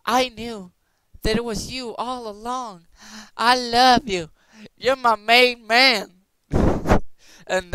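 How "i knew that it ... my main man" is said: high, loud, swooning crush voice; crush declaring love. Retold or imitated. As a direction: gush it like a lovestruck crush. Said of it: "your crush appears and says".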